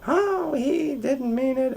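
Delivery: high, soft, drawn-out mom voice; imitated mother, high and placating; mock mom. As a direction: coo a slow excuse in falsetto. Voices his mom excusing stepfather.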